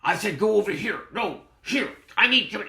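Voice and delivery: high, loud barked orders at an underling; gruff mock yell, high and loud. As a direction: imitate a gruff, yelling bully. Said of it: "yell at me in a gruff voice".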